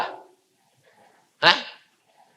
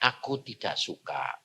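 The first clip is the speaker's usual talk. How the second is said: a calm inner voice speaking gently; voice of God; 13 dB quieter than usual. Acted. God quietly tells the preacher he dislikes the film, hushed and calm.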